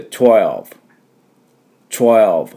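"twelve" in one syllable, tw fused, ending in v; single falling syllable "twelve"; English-style twelve. Shown as a demonstration. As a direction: say "twelve" the English way with no added vowels. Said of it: "って発音します。".

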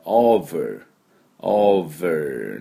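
over with a buzzed V, drawn out; V sound. Shown as a demonstration. Teacher pointing out another V, slow clear V. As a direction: stretch over, teeth on lip for V. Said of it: "これもまたVの音ですね".

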